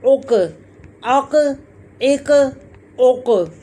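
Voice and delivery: "अक, एक, उक" with a back q echoing as "quh"; each of "अक, एक, उक" released with a short rebound; qalqalah on qaf. Shown as a demonstration. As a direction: bounce the throaty q in "अक, एक, उक". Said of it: "इसको हिलाया जाएगा".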